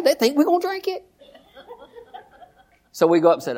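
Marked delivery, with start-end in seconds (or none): 0.0-1.1 embarrassed. Retold or imitated. Embarrassed at wine store.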